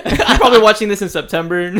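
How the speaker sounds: giggling while talking; laughing. Real, not acted. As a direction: say it through laughter. Laughing joke.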